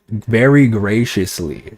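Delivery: slow, each word of "Very graciously" drawn out with scorn; mocking emphasis. Real, not acted. Mocking roommate's word, slow and leaning on "Very graciously". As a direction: savour "Very graciously" slowly, sneering at it. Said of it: "I love the adverb there".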